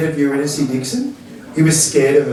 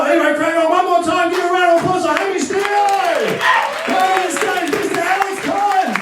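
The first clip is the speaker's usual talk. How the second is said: much higher, fast shouted MC call; hyped host announcement; 12 semitones higher than usual. Real, not acted. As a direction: call the next comic up like a hype MC. Host calling next act up.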